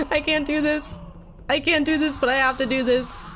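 high, frightened whine; panicked. Real, not acted. Player dreading what she has to do, panicked. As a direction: wail it high, psyching yourself up in fear. Terrified before jumping down.

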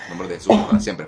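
exorcism command. Real, not acted. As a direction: cry it out as a forceful order. Commanding demon out.

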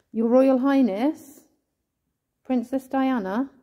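calling out. Real, not acted. Calling out to Diana's spirit.

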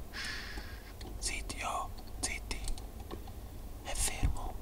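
hushed whisper, hiding from the enemy; whispering while hiding. Real, not acted. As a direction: whisper so the enemy can't hear.

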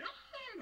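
high laughing imitation of the fairy's yelp; mock hit squeal. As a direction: squeak it out while laughing, lightly. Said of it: "she's like".

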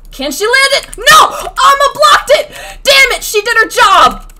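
very loud, high outburst; frustrated screaming. Real, not acted. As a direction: scream the play in anguish. Frustrated at blocked play.

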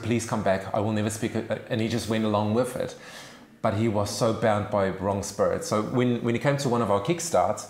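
usual speaking voice

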